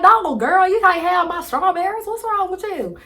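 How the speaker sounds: high, loud hammed-up country accent; mock country accent. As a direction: play a squabbling country girl in a mock country accent. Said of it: "talking in a country accent".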